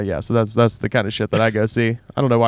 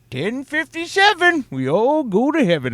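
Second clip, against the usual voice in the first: much higher, slower sing-song chant; eerie stranger again; 13 semitones higher than usual. Retold or imitated. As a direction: intone it like an unsettling rhyme. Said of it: "And he goes".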